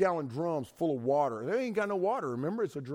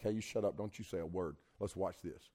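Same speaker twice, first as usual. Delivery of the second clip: low, much softer threat; quiet, firm order to keep still; hushed stern warning; 8 semitones lower, 9 dB quieter than usual. Retold or imitated. God silencing the demon.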